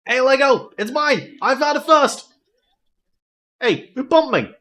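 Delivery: put-on a child guarding his find; bratty kid. Voices a bratty kid NPC.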